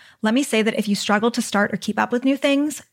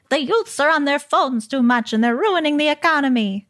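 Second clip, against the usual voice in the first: put-on higher, wobbly old grandma voice; 5 semitones higher than usual. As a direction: play a scolding old grandma. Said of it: "what grandma voice was that that I just attempted".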